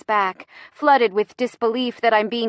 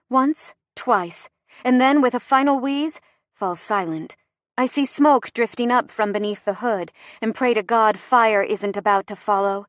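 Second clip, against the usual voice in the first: usual speaking voice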